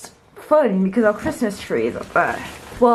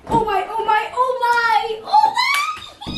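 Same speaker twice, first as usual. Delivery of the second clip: oh my, getting higher each time; rising excitement; 11 semitones higher, slower than usual. Real, not acted. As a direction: climb in pitch with excitement. Excited, reaching the attic.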